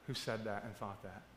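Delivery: soft, low, tender encouragement; gentle reassurance. Retold or imitated. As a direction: comfort him quietly and kindly. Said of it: "I go".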